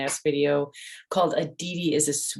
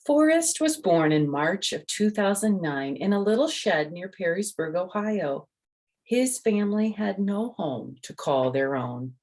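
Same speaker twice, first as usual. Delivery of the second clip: lively, raised storybook voice; reading to children; 4 semitones higher than usual. A narrator's reading. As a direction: tell it like a bedtime story for little ones. Reads the picture book aloud.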